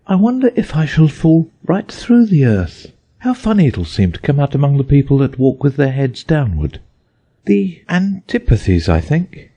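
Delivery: put-on a child imagining upside-down people; young Alice. A narrator's reading. Alice wondering aloud.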